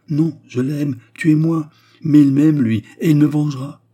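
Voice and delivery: loud, high-pitched defiance; defiant outcry. A narrator's reading. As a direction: cry it out boldly at the would-be killer. Turquoise defies her would-be killer.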